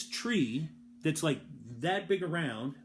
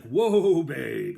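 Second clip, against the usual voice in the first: mule driver's call; 9 dB louder than usual. Retold or imitated. Old man halting his mule with a loud call. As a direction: holler "Whoa" to stop the mule, then drop.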